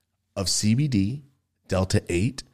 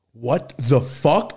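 stunned; 6 semitones higher, 6 dB louder, much slower than usual. Retold or imitated. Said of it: "he walks up and goes".

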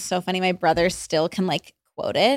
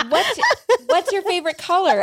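lightly voiced, higher interviewer; 9 semitones higher than usual. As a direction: play a perky interviewer. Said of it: "I was me interviewing them".

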